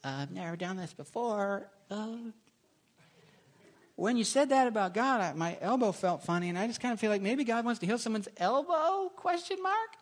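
put-on a nervous girl offering a word; timid girl. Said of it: "she's like".

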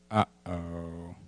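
low, soft uh-oh; worried cartoon dad. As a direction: sink into a subdued father's dread. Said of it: "Daddy, do you believe".

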